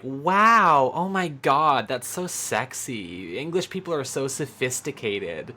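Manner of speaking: gushing mock American fan, high and loud at first, then slow and breathy on "so sexy"; mock-swooning American voice, rising then slowing to a drawn-out purr. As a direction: ham up a starstruck tourist melting over a posh accent. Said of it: "hear that accent and be like".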